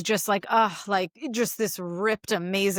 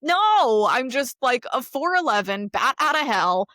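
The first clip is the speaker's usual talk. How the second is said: higher, laughing self-mockery; self-mocking laugh; 6 semitones higher, 5 dB louder, slower than usual. Mocking her own look to her co-host, higher laughing. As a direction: laugh at herself as a bat outta hell. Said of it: "And it's like".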